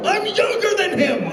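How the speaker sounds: high mock shout of protest; hammed-up offended yell; indignant shout. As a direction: yell it, insulted. Jen protests loudly.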